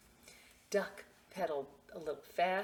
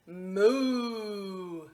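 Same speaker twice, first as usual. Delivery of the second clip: cow moo; 3 semitones higher, 8 dB louder than usual. Acted. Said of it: "said Cow".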